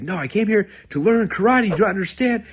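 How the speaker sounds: high, loud frustrated yell at the teacher; student yelling at his teacher that he came to learn karate. Retold or imitated. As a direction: shout it up high like a fed-up kid. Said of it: "he starts yelling".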